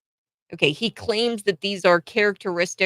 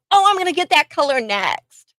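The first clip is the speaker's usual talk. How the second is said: mock gushing; 8 semitones higher, 4 dB louder than usual.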